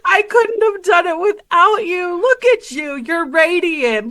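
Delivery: high, emotional gushing; high-voiced heartfelt praise, slowing at the end; gushing admiration. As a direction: pitch up and fawn over a dear friend. [name] hugs Orville, gushing praise.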